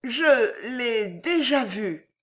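slow, faltering stammer. A narrator's reading. Lady caught out before her suitor, stammering slowly. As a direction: stumble out the admission. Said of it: "balbutia la dame".

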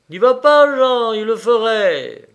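high, loud warning cry; urgent shouted plea. A narrator's reading. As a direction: beg him loudly not to go. Retold plea to stop Jean.

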